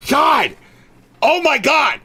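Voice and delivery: high, loud furious yelling at chat; real angry shouting. Real, not acted. Streamer yelling at chat in real anger. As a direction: blow up at them, voice cracking high. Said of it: "I'm so mad".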